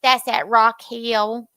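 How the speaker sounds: twangy Rock Hill, South Carolina speech; Rock Hill accent. Shown as a demonstration. Contrasting regional accents for a friend, Rock Hill twang. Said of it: "that Rock Hill kind of fast accent".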